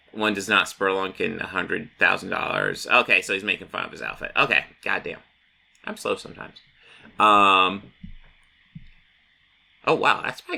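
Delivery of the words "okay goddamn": usual speaking voice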